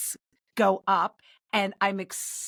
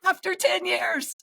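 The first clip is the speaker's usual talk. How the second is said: much higher and slow, a thrilled squeal; excited squeal; 9 semitones higher, slower than usual. Real, not acted. Telling the hosts about her engagement, giddy squeal. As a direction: gush it out, giddy.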